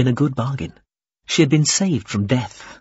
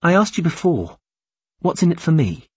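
higher, lightly voiced pressing woman; a touch of raised-pitch impatience; demanding captive; 7 semitones higher, 3 dB louder than usual. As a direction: press for your share.

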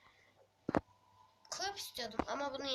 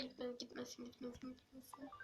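much softer, breathy hope it stays put; hushed pleading; 12 dB quieter than usual. Real, not acted. Gamer whispering to the screen, hoping the item stays, hushed pleading. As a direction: whisper it like a wish.

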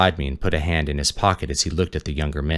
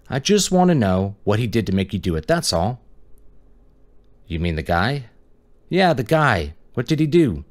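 put-on young detective pushing hard, voice raised and high; pushy detective; 6 semitones higher than usual. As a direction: lean on the kid to confess.

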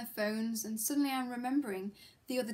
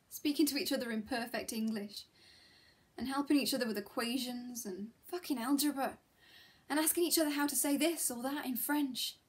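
ordinary delivery

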